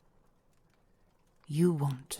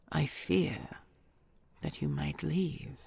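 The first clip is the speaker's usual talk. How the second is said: usual speaking voice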